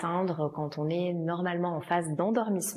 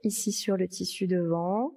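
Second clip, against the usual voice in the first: usual speaking voice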